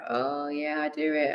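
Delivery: small, high, soft bashful voice; awkward. Retold or imitated. Vlogger sheepishly answering a fan who recognised her, awkward. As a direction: re-enact a sheepish, embarrassed admission, quietly.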